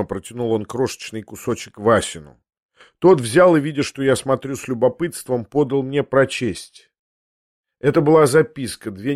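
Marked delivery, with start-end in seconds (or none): none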